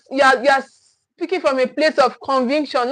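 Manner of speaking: usual speaking voice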